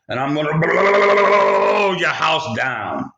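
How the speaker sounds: wolf shouting at the brick house; wolf's big threat. Acted. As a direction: drag out the final threat.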